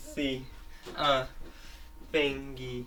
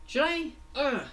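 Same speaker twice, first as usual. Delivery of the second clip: acted struggle; 13 semitones higher, 4 dB louder than usual. Fango grapples attacking Forky.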